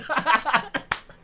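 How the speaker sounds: high-pitched helpless laughing fit; loud real laughter. Real, not acted. Streamer cracking up at a viewer's joke, laughing. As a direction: burst out laughing hard and high. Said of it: "I'm laughing so fucking loud".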